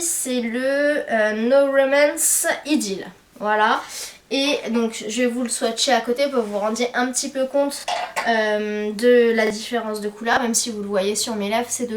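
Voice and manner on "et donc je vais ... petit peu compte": normal talking voice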